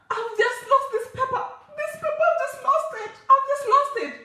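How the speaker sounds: high-pitched distressed retelling; panicked pleading. Retold or imitated. Acts panicked plea over lost paper.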